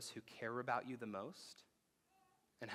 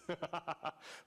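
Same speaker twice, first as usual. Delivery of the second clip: high laugh; 7 semitones higher, 3 dB louder than usual. Real, not acted. Laughs at kids warning.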